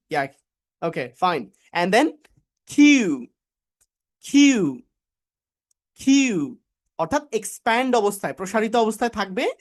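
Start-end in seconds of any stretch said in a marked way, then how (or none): 2.7-6.6 "কিউ" pushed out in one burst; punchy aspirated "কিউ", falling at the end; model pronunciation. Shown as a demonstration. Teacher drills how the sound comes out, model pronunciation. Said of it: "শেষে এসে একসাথে আওয়াজটা বের হবে".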